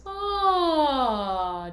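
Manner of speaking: an open relaxed sigh on ah; demo sigh. Shown as a demonstration. Teacher recalls the sighs by sounding a relaxed ah. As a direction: release the ah as an easy falling sigh. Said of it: "We did some sighs".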